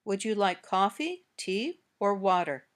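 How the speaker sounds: "coffee" and "tea" rise, "water" falls; choice-question intonation. Shown as a demonstration. Teacher gives learners a list of choices, rises then fall. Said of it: "Now say these sentences".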